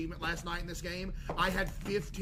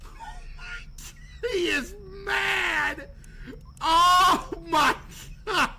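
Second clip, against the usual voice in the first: high, loud laughing shriek; howling laugh; 13 semitones higher, 9 dB louder, much slower than usual. Real, not acted. Streamer cracking up at a furious suspect, shrieking. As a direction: laugh it out, barely able to speak.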